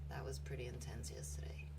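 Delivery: soft whispered aside; whispering after the intense story. Real, not acted. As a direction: breathe it out under the breath. Quiet aside after heavy story.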